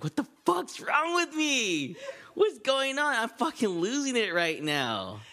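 high, slow mock sobbing words; imitating bawling. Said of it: "I start bawling".

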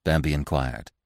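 ordinary delivery

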